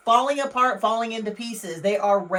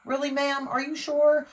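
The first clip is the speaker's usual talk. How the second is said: disbelieving police; 6 semitones higher than usual. Retold or imitated. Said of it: "the police are kinda looking at them like".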